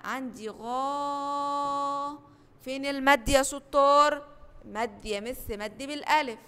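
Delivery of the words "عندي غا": غا held long and slow; stretched syllable. Shown as a demonstration. Teacher isolating the long syllable for pupils, slow. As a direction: draw out غا slowly. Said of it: "يبقى في كلمة غابة".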